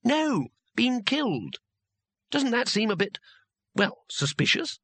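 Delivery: high-pitched, questioning character; incredulous character voice. A narrator's reading. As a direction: play it high and puzzled, sweeping down on key words.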